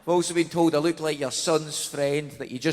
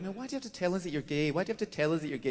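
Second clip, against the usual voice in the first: fast, soft mock complaint; imitated heckler, quick and under the voice; grumbling audience member; 9 dB quieter, much faster than usual. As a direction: rattle it off as an uncomfortable punter. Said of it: "some audience members are funky about it".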